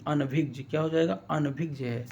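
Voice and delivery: normal talking voice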